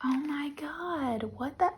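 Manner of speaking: breathless stunned whisper; real amazed hush; awed whisper. Real, not acted. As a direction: gasp it out in awe. Unboxing beautiful end papers.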